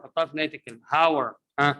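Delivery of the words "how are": "How are" joined as one word, "hower"; linked reduced are. Shown as a demonstration. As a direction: tack "are" onto "How" as a bare R. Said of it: "in the end of the previous word".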